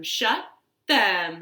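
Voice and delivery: ordinary delivery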